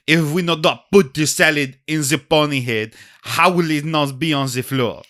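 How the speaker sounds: lightly done French waiter; mild French waiter accent; thick French accent. Acted. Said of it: "The waiter has a very thick French accent".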